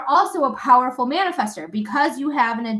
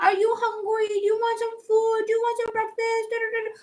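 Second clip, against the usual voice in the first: put-on much higher cooing baby talk, softer; talking to a pet; 10 semitones higher, 3 dB quieter than usual. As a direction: coo at the dog like a baby. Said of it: "And then you'd be like".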